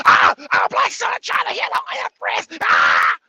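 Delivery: high, loud, put-on voice screeching the accusation; mock outraged commenter. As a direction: ham up a scandalised viewer yelling. Acting out a commenter's outburst.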